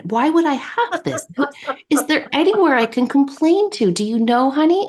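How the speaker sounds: high-pitched, put-out complaint; indignant complaining mom. Retold or imitated. Mother complaining to daughter about a pimple, high and indignant. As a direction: pitch it up like a mom fussing over a flaw in heaven. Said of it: "she was complaining".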